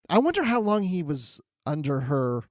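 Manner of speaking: normal talking voice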